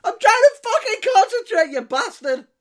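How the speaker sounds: high, loud furious yell; furious driver yell. Retold or imitated. Driver yelling at giggling passengers, loud and furious. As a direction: bellow at them like you're about to crash.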